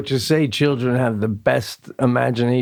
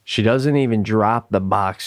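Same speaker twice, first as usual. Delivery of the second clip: ordinary talk, nothing marked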